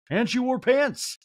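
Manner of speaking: high, slow rising wail; mock dismay. Garcelle in a talking head pitying Sutton, mock wail. Punchline of retold sad confessional.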